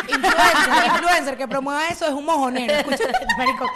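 real laughing outburst; blurting out a laughing rant about influencers. Real, not acted.

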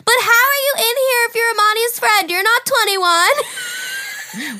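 high shouted challenge, stretched at the end; drunken yelling. Retold or imitated. Drunk girl questioning an underage kid, high shouting. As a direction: yell it like a baffled drunk. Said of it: "So I'm, like, yelling".

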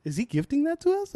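high, eager mock question; imitating a thrilled shopper; squeaky hopeful ask; giddy customer. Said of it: "she's like".

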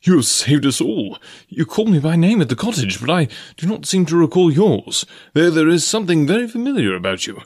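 high, animated gentleman's voice; exclaiming. A narrator's reading. Clayton thanks a rescuer who seems familiar, exclaiming. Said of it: "he exclaimed".

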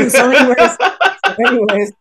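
laughing through the words; giggling as he tries to move on. Real, not acted. Laughing after joke.

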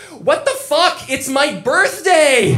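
high, loud outraged yell. Retold or imitated. Girlfriend punching him on her birthday, a high outraged yell. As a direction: shriek it at him in disbelief. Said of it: "And she yells".

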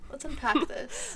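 slow youth leader delivery; playful youth pastor impression; upbeat youth pastor. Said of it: "I sound like a youth pastor".

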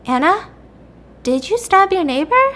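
careful question, climbing high at the end; acted wary questioning, with a pause after the name; suspicious operator. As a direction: ask it gingerly, voice lifting in doubt.

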